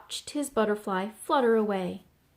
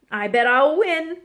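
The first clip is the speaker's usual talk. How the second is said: higher, loud, cocky; quacked brag; 4 semitones higher, 7 dB louder than usual. A narrator's reading. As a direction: crow it like a confident duck. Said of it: "quacked Thistle".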